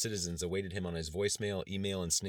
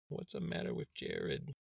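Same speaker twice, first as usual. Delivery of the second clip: slow, soft, whispery little plea; hushed, sorrowful question, drawn out; mournful child; 5 dB quieter, slower than usual. A narrator's reading. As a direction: ask it mournfully, almost without voice. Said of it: "mournfully asked".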